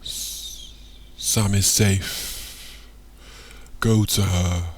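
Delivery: slow, drawn-out echoing voice. A narrator's reading. Hoto's voice calling to Michael, slow and echoing. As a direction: speak like a voice echoing in a dream. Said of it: "echoes Hoto's voice".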